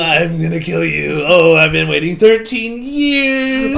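loud, slow evil threats; menacing villain rant. Retold or imitated. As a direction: lean into the menace. Steve's villain rant continues.

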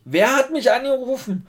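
high, slow baffled question; confused operator asking who; puzzled operator. Retold or imitated.

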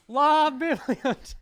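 loud laughing mock Spanish; laughing shout. Real, not acted. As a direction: burst out laughing on it. Mocking the phrase loudly.

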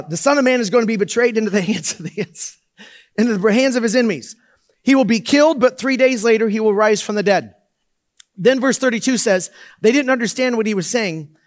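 lifted scripture read with a laugh slipping in. Acted. Reads scripture aloud.

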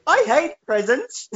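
a hammed-up villain quip; silly character voice. Mock villain quote in bit.

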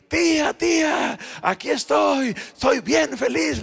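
loud, high, slow call across the market; a boy yelling across the market to his aunt; shouting to his aunt. Retold or imitated. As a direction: shout it out happily to her. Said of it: "le empecé a gritar".

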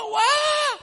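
very high, loud wow; a thrilled shout of wonder; amazed cry. Retold or imitated. Preacher imitating people amazed at the temple, loud high wow. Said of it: "Sabi nung iba".

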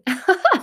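quick high laugh; real laugh; short laugh. Real, not acted. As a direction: laugh at the joke. Laughs at own joke.

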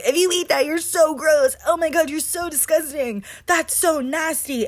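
high-pitched mock scolding, appalled roommate; retold yelling. Roommate berating her over the dirty pan, high-pitched mock yelling. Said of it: "yelling at me".

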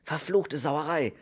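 drawn-out, high-pitched curse; angry cry. A narrator's reading. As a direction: curse in exasperation. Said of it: "schrie Kern". (wütend geflucht)

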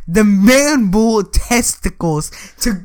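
usual speaking voice

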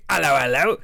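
trying and failing at Cockney; badly attempted Cockney greeting; failed Cockney attempt. Shown as a demonstration. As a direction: have a go at a Cockney "ello, ello". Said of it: "I can't say".

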